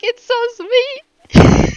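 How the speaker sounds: slow, high, drawn-out squeal of delight; swooning coo. Retold or imitated. Fan melting over a sweet moment, slow high swoon.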